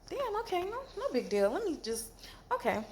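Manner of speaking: high, startled, brushing it off; a squeaky stunned "okay, fine"; taken aback. Retold or imitated. Shocked by rough treatment.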